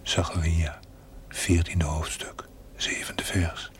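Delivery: a hushed, voiceless whisper quoting scripture. Acted. Ghostly voice whispering Bible verses to the chaplain. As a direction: breathe the verses out without voice.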